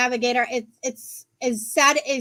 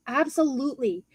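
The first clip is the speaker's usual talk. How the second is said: usual speaking voice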